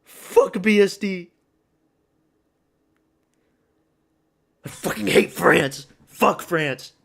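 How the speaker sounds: loud, high, slow furious swearing; angry cursing. Real, not acted. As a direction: spit out the curses in rage. Cursing after the loss.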